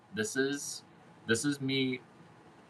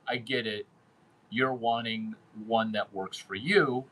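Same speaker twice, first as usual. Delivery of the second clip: ordinary talk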